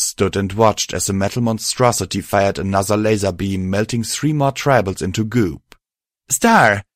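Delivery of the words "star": sharp high call of a name; shouting. Acted. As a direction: shout the name across the battlefield. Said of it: "Violet shouted".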